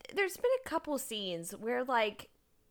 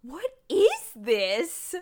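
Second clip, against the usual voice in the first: confused; 7 dB louder, much slower than usual. Retold or imitated. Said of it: "I'm so confused".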